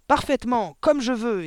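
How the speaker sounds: loud, high angry outburst; furious, emphatic headmistress shout; exploding. A narrator's reading. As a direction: explode at the teacher. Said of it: "explosa mademoiselle Le Gourdin".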